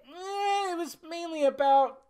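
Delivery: high, drawn-out noncommittal whine; doubtful waffling. Acted. Whines a doubtful answer to own question.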